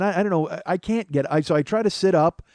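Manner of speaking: normal talking voice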